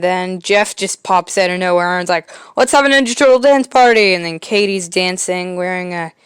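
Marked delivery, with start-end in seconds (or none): 2.5-4.1 excited. Retold or imitated. Jeff bursts in suggesting party.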